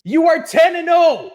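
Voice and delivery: high, loud disbelief; exasperated shout. Acted. Vlogger shouting the team's record at the Steelers, loud and incredulous. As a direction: shout the record back at them.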